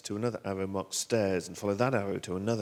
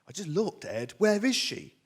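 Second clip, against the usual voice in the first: higher, louder, building to a cry; panicked wife; 10 semitones higher, 8 dB louder than usual. Acted. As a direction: demand an answer in rising panic. Holly alarmed, child is missing.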